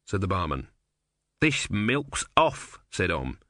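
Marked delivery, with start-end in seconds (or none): 1.4-2.8 slow, high, sour complaint; disgusted god. A narrator's reading. Om complaining about the sour milk. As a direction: turn your nose up at it.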